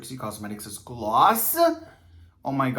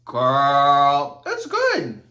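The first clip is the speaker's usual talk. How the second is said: sassy excited; 8 dB louder, much slower than usual. Real, not acted. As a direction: gush about it, full sass. Gushing about the drink.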